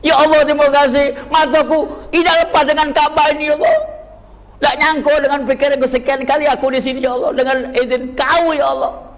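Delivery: high, crying voice pleading to God; sobbing thanks in prayer; tearful prayer. Retold or imitated. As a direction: weep out thanks at the Kaaba. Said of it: "aku nangis".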